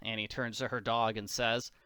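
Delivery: usual speaking voice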